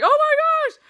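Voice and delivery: loud, very high shocked cry; shocked exclamation. Retold or imitated. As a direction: gasp it out in fright. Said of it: "And I'm just like".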